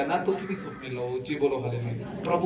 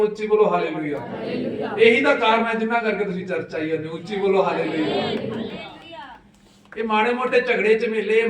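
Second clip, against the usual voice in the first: high, loud exhortation; raised, impassioned sermon voice; full-force preaching; 5 semitones higher, 6 dB louder than usual. Real, not acted. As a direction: preach it out to the whole congregation.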